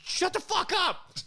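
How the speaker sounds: very high, loud re-enacted yell. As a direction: scream it at the device in exasperation. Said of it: "So I yelled".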